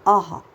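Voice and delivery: आहा with the ह sound clearly heard; voiced h ending; aha with an audible h. Shown as a demonstration. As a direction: let the ह be heard at the end of आहा. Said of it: "ह की".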